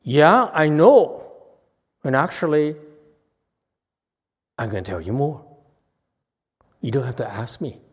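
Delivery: model student reply. Shown as a demonstration. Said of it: "this is how we Chan people talk".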